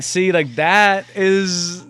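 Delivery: high, loud, stretched-out awe; mock amazement. Imitating a monkey after the trick, amazed. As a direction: exclaim it as if blown away.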